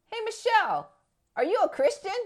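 high-pitched retold greeting question; friend calling out. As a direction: act out a friend hailing her. Friend calls out to her.

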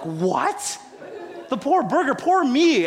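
high-pitched incredulous protest; mock indignant disbelief. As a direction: act out an outraged wife, voice shooting up. Retold wife's outraged reply.

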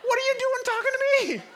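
high, loud mock scolding; hammed-up incredulous outburst; exasperated yell. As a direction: yell at him to get moving. Pastor berates dawdling usher.

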